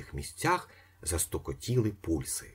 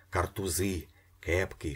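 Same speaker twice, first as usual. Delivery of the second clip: usual speaking voice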